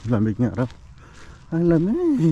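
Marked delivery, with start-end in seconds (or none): 1.5-2.3 high loud bird cry. Retold or imitated. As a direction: squawk it like a cold bird.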